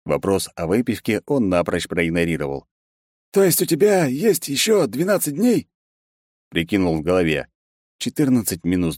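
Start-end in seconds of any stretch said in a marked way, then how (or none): 3.3-5.7 high-pitched, probing question; secretary's eager voice. A narrator's reading. Secretary working out the boss's deadline, high and probing. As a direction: ask it high and keenly. Ben's character voice.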